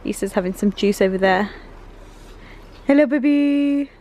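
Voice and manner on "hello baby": high, drawn-out singsong hello. Real, not acted. Mother greeting her baby, high cooing singsong. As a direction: coo it slowly to a little one.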